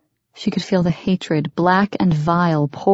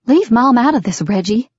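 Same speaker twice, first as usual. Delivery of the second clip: raised, louder protest; upset demand; 6 semitones higher, 4 dB louder than usual. A narrator's reading. Daughter tells father off about Mom, upset demand. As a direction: warn him off, pitch raised.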